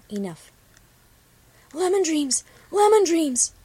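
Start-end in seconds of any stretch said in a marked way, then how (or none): 1.7-3.5 high, loud cry of a friend's name; frantic call across the slope; screeching call. A narrator's reading. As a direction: shout her name in alarm. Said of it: "Copper screeching in her ear".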